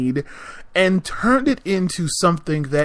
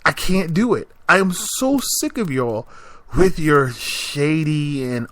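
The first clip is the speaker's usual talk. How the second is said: ordinary delivery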